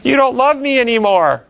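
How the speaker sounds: put-on a small child begging Mommy for an answer; hurt toddler's voice. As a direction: parent voicing the child during a shot, pleading and high. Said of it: "what might be going on in the child's mind".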